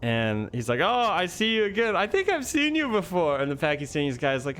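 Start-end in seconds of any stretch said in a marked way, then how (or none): 0.8-3.4 high, teasing mock recognition; joking interpreter. Interpreter ribbing the Pakistani detainee, imitated joking. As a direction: put on a high, playful imitation. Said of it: "just joking around".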